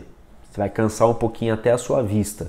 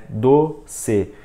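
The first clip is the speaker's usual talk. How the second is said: DOCE split into two spaced syllables, DO, CE. Shown as a demonstration. Teacher gives a two-syllable example, syllable by syllable. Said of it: "duas sílabas".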